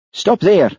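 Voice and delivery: sudden. A narrator's reading. Hamish suddenly stops the outtake tape, urgent. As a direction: blurt it out all at once. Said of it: "said Hamish suddenly".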